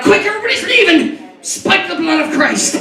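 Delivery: hammed-up frantic priest; panicked priest. Priest rushing out to stop the crowd, high panicky. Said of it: "The priest comes running out".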